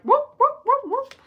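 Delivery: high, loud whooping coyote calls; coyote howl. Retold or imitated. Describing city coyotes at night, howling whoops. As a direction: yip like coyotes answering sirens. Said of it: "they'll start, like".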